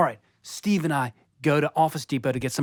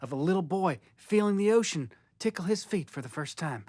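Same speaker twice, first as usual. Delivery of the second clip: ordinary talk, nothing marked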